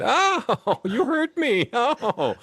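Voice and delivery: high, hammed-up wail of fake pain with a laugh; mock-wounded drama queen. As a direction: overplay being hurt by a tiny elbow bump, laughing through it. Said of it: "me being the drama queen that I am".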